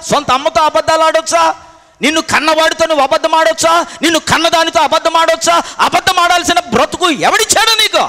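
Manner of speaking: loud, high-pitched rebuke from the pulpit; indignant preaching. Real, not acted. Preacher rebuking those who lie to their own parents, indignant full-force preaching. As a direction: hammer out the accusing questions at full force.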